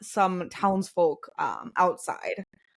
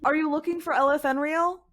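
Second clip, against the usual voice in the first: higher-pitched townsman's voice; helpful townsman; 7 semitones higher than usual. Acted. Townsman directs visitors to the temple, higher-pitched and helpful. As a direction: point strangers onward in a raised pitch. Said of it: "He goes".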